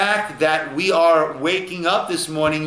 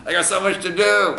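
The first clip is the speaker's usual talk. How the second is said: acted weary waking-up complaint; mock tired whining; bad morning attitude. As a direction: grumble like someone dragging themselves up. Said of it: "very often our attitude".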